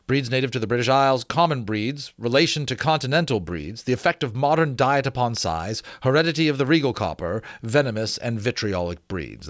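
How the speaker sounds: put-on measured, formal reading of an old treatise; formal reading aloud. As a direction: read it like a learned gentleman's letter. Reads table of contents.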